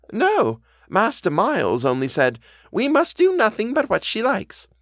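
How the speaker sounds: put-on high-pitched housekeeper quoting her young master; earnest housekeeper. A narrator's reading. Housekeeper repeats Miles's words to the governess, high and earnest.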